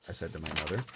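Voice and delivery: ordinary delivery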